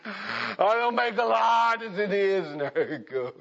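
high, loud, hammed-up wail over lost money; mock wailing complaint. Preacher mimics a young'un crying over a bonehead deal, hammed-up wail. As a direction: wail like a young'un who just lost his savings. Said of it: "And you'll be thinking".

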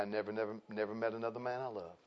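very soft, low, tender confession; grandma's quiet confession. Retold or imitated. Grandma confiding to her grandson, very soft and low. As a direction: hush it like a painful secret. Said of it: "She said".

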